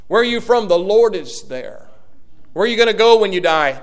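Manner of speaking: usual speaking voice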